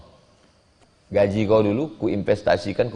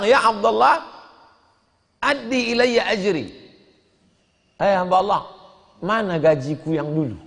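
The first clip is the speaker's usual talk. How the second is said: worker demanding his pay; 10 semitones higher than usual. Acted. Worker demands his old wages.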